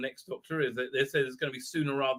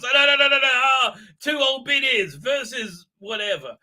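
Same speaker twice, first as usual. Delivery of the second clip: high, loud flustered no no no; hammed-up alarm at the fight; mock panic; 7 semitones higher, 5 dB louder than usual. Acted quote of fighting scene.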